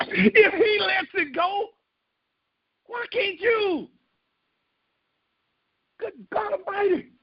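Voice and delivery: high, crying-out sermon climax; preaching at full force. Acted. Pastor rousing the congregation, high impassioned cry. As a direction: cry it out from the pulpit.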